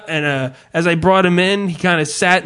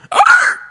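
sharp high yelp of a hurt dog; imitated dog yelp; 6 dB louder than usual. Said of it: "That was a very good yelp".

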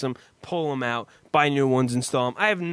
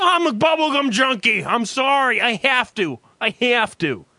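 high, loud hammed-up gum junkie; giddy salesman; 6 semitones higher, 5 dB louder than usual. Imitating the salesman apologizing for taking gum, hammed-up giddy. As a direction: gush like a candy-crazed salesman. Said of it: "He's like".

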